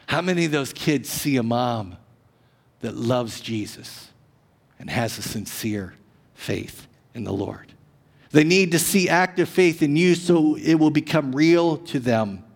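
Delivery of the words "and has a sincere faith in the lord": ordinary talk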